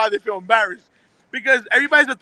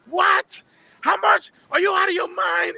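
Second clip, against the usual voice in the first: imitating a shocked customer, much higher and shouting; hammed-up disbelief at the price; mock outraged yell; 8 semitones higher, slower than usual. Customer yelling at the repairman over the price, mock outrage.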